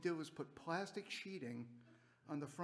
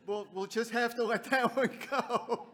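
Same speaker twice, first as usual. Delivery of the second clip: louder, higher, laughing through the words; laughing through a retold reply; 5 semitones higher, 7 dB louder than usual. Telling Jacob no beer today, laughing and louder. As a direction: answer kindly while still cracking up. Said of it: "And I said".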